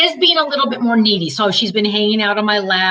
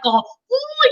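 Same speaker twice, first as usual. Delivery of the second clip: high cooing "ooh"; cooing at twins. Retold or imitated. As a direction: coo sweetly at someone's babies. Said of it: "I would be like".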